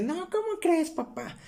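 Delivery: playful falsetto scoffing at the news; mock disbelief. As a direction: ham up a scoffing "no way". Mocking disbelief in quoted reply.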